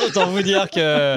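slow, laughing through the words. Real, not acted. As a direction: giggle while you speak. Laughs after drinking the coke.

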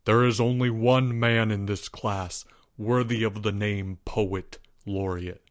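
low and soft, steady and grave. A narrator's reading. Student answering his teacher's challenge, low and soft. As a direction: say it low and serious. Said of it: "in a low voice".

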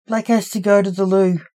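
usual speaking voice

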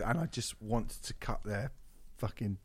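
dropping to a low mutter; quiet. Real, not acted. Muttered violent aside.

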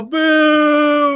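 high, loud ghost boo. Shown as a demonstration. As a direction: shout a spooky boo. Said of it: "that's how you boo".

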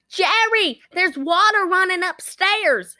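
high, drawn-out call upstairs; acted calling out. Daughter telling Jerry about the water, mock high-pitched holler. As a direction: imitate a daughter calling out, stretched.

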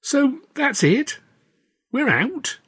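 slow, high stunned disbelief. Acted. Stan stunned at being dropped from Carno, slow and high. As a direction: let it hang, slow and dazed.

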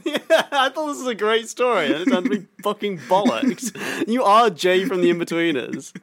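high laughing complaint; laughing outrage. Real, not acted. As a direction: laugh while protesting loudly. Outraged at fake story.

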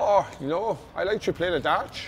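put-on comic Dutch accent, chatty; mock Dutchman, casual delivery. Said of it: "He's a wee Dutch accent. You're good at that".

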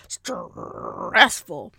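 "stressful" with a long growled R; exaggerated growl, slowly drawn out. Shown as a demonstration. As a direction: growl through the first syllable of "stressful". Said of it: "You heard the growl".

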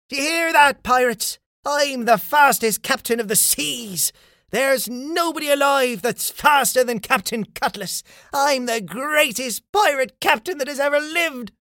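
high, boastful pirate captain's call; triumphant shout. A narrator's reading. Captain Cutlass boasts to pirates after winning, shouting. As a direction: crow your victory to the whole crowd. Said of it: "Captain Cutlass began to shout".

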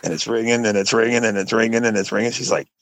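ordinary talk

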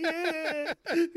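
high, laughing agreement; mock giddy, helpless yes; imitated laughing. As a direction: squeal it out through put-on laughter. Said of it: "while he's laughing".

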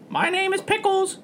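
high cartoon-mascot squeak; Mickey Mouse. Introducing Pickles the parrot, sounding like Mickey Mouse. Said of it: "That sounded like Mickey Mouse".

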